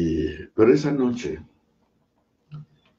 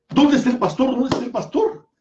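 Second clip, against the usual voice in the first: calling out; 4 dB louder than usual. Retold or imitated. Boy calling out for the pastor.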